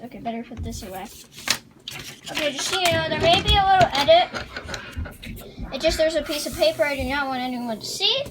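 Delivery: normal talking voice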